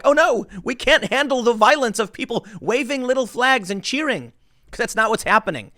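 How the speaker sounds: mock the cops' fake panic; mock cops. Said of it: "the cops were like".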